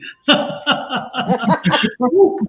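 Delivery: high, loud real laugh; loud giggling fit; burst of laughter. Real, not acted. Laughing at the heat she feels.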